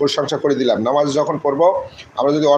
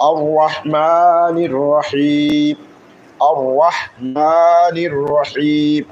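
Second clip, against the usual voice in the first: drawn-out chanted recitation of the verse; slow Quran recitation; much slower than usual. Shown as a demonstration. Teacher reciting the verse for students to follow, slow chant. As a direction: chant it slowly, holding each vowel.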